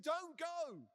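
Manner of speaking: high, loud desperate cry; desperate plea. Retold or imitated. Saul begging Samuel not to leave, desperate plea. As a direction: cry out as if clinging to someone.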